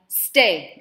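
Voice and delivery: loud, curt one-word command; sharp order. Acted. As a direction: stop her in her tracks. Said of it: "Madam ordered".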